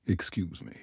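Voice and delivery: put-on soft, low Scottish accent, a timid approach; a quiet Scottish man, carefully interrupting. A narrator's reading. Worried Scottish stranger to helpful group, soft Scottish accent. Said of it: "in his Scottish accent".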